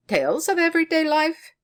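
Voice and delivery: high, loud, incredulous question; puzzled question. A narrator's reading. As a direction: echo the phrase back in disbelief. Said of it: "said our bachelor inquiringly".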